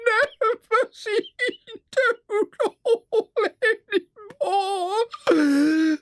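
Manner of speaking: very high, broken, drawn-out wail; sobbing. A narrator's reading. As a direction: blubber despairingly between gulping sobs. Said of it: "sobbed the toad into the sofa cushions".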